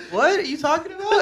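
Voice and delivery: high, laughing confused quote; retelling own confusion with a laugh. Retold or imitated. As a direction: act baffled, voice jumping up. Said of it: "I was like".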